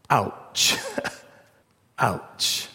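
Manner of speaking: whispered with a chuckle; a rueful little ouch; hushed ouch. Real, not acted. Preacher reacts to verse.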